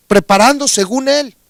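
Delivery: usual speaking voice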